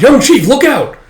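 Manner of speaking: very high, loud yell; warning shout. Acted. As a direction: shout the warning across the field.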